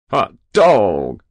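loud, grinning whoop; ecstatic cry. A narrator's reading. Hero hearing newsboys call his name, ecstatic. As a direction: cry out in delight. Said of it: "he cried, grinning, ecstatic".

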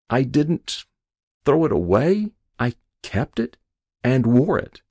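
high, halting patient voice; waking patient. A narrator's reading. Patient remembering his patched coat, high halting voice. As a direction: piece the memory together, pausing between phrases.

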